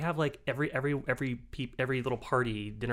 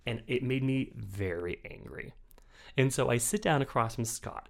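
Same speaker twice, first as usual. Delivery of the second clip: ordinary talk, nothing marked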